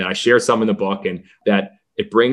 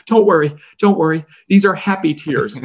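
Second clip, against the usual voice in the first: put-on higher-pitched, earnest child voice; reassuring kid; 7 semitones higher, 3 dB louder than usual. Crying young fan to team owner, high reassuring kid voice. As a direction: imitate a small boy insisting he's fine.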